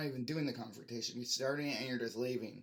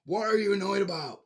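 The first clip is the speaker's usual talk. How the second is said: loud, high irritated question; real annoyance, pitched up and loud; annoyed outburst; 6 semitones higher, 10 dB louder than usual. Real, not acted. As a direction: fire it back in irritation. Ranting at dismissive people.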